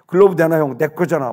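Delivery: retold shouted demand, pushy and insistent; loud demand. Boy demands his stolen glove from an older player, loudly. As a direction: loudly insist on getting it back.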